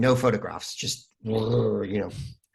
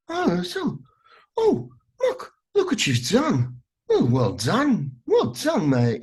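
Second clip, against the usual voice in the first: put-on northern English accent; 12 semitones higher, 3 dB louder, slower than usual. Guest imitating the English Bob the Builder actor, northern English accent. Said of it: "has kind of a northern, uh, English accent".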